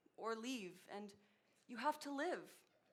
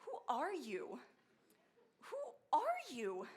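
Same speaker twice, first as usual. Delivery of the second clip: bewildered inner voice; 5 semitones higher, slower than usual. Retold or imitated. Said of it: "you think to yourself".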